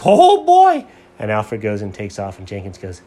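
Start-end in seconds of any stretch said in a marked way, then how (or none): 0.0-0.9 very high, loud dog yelp of joy; thrilled puppy. Acted. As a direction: burst out like an excited dog. Dog runs after thrown ball.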